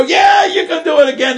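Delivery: cheering crowd yelling back, pitched high; excited crowd calling out; crowd shouting. Retold or imitated. Crowd cheering the tightrope walker.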